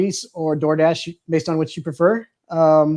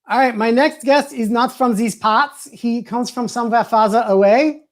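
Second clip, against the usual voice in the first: a slow, hammed-up Irish accent, a bit higher; 4 semitones higher, slower than usual. Host introducing his next guest in an Irish accent. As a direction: put on your best Irish brogue. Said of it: "That's my best, uh, uh, Irish accent".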